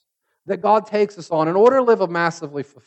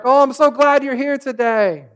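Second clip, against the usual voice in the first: fake sweet; 6 semitones higher, 7 dB louder than usual. Fake coworker being sweet.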